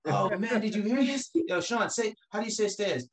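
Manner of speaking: high, loud mocking classmate; mock-amazed schoolkid jeering; teasing kids. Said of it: "people come up to me like".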